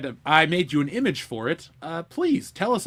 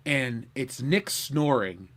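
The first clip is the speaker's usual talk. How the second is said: normal talking voice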